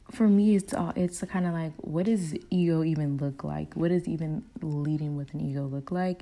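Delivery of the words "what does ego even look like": normal talking voice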